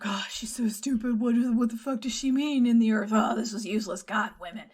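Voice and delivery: exasperated griping, loud in the middle; mock exasperation. Men griping about the crazy lady's riddle, mock exasperated. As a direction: grumble like a man fed up with nonsense. Retelling the men's exasperated complaint.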